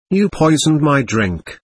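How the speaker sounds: ordinary delivery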